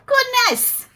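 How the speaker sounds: high squeaky exclaim; mock shock. Reacting to the water-slide fart, a high mock-shocked yelp. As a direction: yelp it in fake horror.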